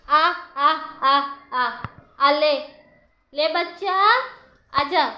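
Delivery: loud, high repeated beckoning calls; calling a pet. Real, not acted. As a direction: call out to coax a bird over.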